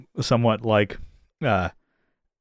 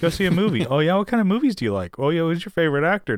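high, quick run of small-talk questions; mock eager chatter; 7 semitones higher, faster than usual. Mimicking someone who keeps chatting.

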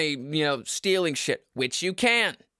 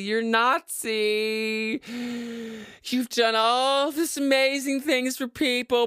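mocking accuser; 3 semitones higher than usual. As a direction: stretch the accusation in a mock voice. Mocks people throwing word around.

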